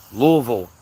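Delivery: Louisville as LOO-vull; local pronunciation. Shown as a demonstration. Teaching the hometown way, Louisville as LOO-vull. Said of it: "If you don't say it like that".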